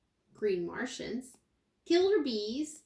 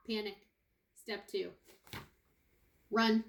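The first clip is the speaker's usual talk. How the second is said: ordinary delivery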